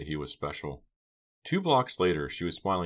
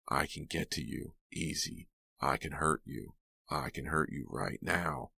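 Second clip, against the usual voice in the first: soft, menacing rasp; hushed threat; 5 dB quieter than usual. A narrator's reading. Attacker threatening to hurt Pam, hushed menace. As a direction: threaten quietly, without raising the voice.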